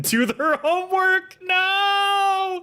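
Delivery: rising into a high, loud yell; hammed-up dad yelling orders; mock parental yelling. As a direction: build to a long, high pretend shout. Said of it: "You're yelling at them".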